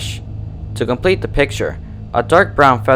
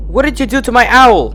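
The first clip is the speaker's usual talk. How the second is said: high, loud fearful wail; 12 semitones higher, 4 dB louder than usual. A narrator's reading. Twilight wails at the doll over her owl. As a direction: wail the question in fear. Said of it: "She wailed in fear".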